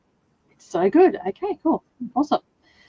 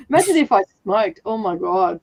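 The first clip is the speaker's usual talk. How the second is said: ordinary delivery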